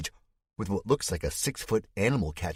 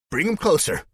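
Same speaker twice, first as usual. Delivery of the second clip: high, loud shouted command; yell; 11 semitones higher, 7 dB louder than usual. Acted. Spike ordering soldiers over the crowd, loud high yell. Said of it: "Spike yells".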